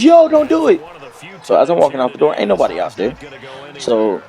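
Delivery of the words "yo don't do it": high, loud call from across the room; high-pitched shout telling him to stop; shouted warning. Retold or imitated. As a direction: yell it after someone leaving. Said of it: "all I hear is".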